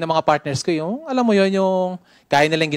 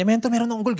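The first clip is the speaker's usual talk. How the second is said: higher, eager pitch to the mentor; eager announcement; 6 semitones higher than usual. Retold or imitated. Student telling mentor his new goal, excited. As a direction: blurt the goal out like you can't wait. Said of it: "Gigil na gigil ako eh".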